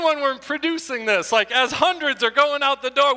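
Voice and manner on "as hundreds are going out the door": ordinary talk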